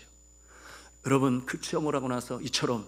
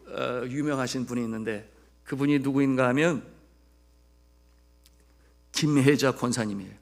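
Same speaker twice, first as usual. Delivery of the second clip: ordinary delivery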